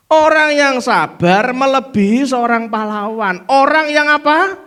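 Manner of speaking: loud, high preaching build-up; fiery preaching. Acted. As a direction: preach it hard to the crowd. Preaching at full force.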